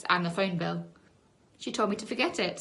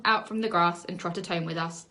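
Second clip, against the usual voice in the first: usual speaking voice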